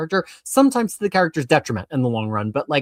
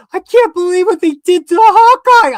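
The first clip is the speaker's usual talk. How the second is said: high, loud, slow mock sob; mock crying; 11 dB louder than usual. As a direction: wail it like a heartbroken kid. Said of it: "she's on the bed crying".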